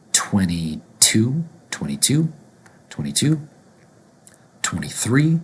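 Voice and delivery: "twenty" as "twenny" before each digit, rising; twenties with N. Shown as a demonstration. Teacher drilling twenty-two for learners, N sound. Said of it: "will pronounce with an N instead of a T".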